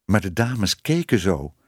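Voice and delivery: plain reading voice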